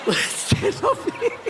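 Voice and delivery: high, laughing, slow. Real, not acted. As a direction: squeeze the words out through laughter. Quoting nurse while laughing. (riéndose sin parar)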